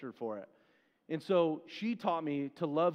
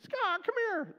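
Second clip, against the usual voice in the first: put-on high-pitched grandma ordering a child over; very high mock grandma holler; grandma's scolding summons; 4 dB louder than usual. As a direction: imitate a disciplining grandma calling out. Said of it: "That's the way she'd say it".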